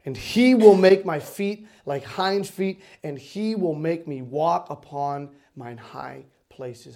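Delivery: low, slow, solemn pulpit declaration; measured, reverent preacher's reading; scripture recitation. Acted. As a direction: declaim each phrase with weight and pauses.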